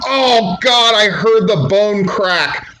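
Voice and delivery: high, loud, slow horrified cry; shocked pop. Shown as a demonstration. Demonstrating the pop after the chair shot, horrified loud cry. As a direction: let the tension burst in horror. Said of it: "And then pop with that chair".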